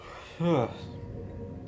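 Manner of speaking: long tired yawn. Real, not acted. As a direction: let out an audible yawn. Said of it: "sorry that I yawn so much".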